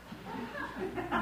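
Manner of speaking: a quick breathy laugh before reading; short laugh. Real, not acted. Reader laughing before starting the chapter, a short laugh. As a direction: let a little laugh slip out.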